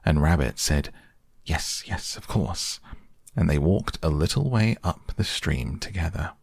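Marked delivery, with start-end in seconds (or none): 1.4-2.8 quiet, breathy yes yes; hushed reply. Acted. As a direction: answer in a hush. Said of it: "Christopher Robin whispered to Rabbit".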